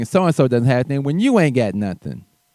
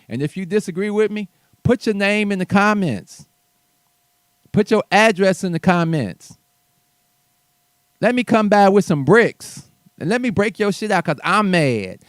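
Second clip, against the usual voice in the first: sarcastic mock threat; 7 semitones higher than usual. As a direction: play the petty vandal, pitched up and pushy.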